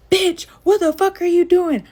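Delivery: loud, high, drawn-out shout; imitated angry yell. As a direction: holler it after someone fleeing, slowly stretched. Man with pistol yells after her.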